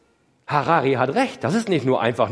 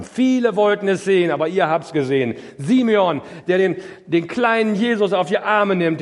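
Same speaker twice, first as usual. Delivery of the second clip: higher, louder pulpit voice; rising sermon fervour; preaching swell; 6 semitones higher, 4 dB louder than usual. Acted. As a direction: lift it like a proclamation.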